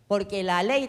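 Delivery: ordinary talk, nothing marked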